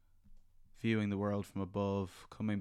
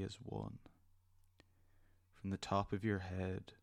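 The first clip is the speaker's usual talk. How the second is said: soft spa whisper; 4 dB quieter than usual. Acted. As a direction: gently lead them into calm. Leads a guided meditation.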